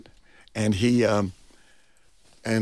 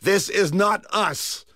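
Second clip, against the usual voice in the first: indignant protest; 8 semitones higher, much slower than usual. Retold or imitated. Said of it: "pointed at the screen and said".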